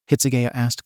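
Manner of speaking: plain reading voice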